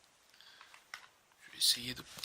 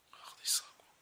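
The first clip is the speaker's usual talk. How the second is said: awed whisper; 7 dB quieter than usual. Real, not acted. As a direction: breathe it out in wonder. Admiring the card.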